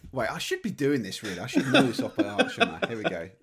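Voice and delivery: ordinary talk, nothing marked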